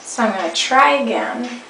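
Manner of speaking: ordinary talk, nothing marked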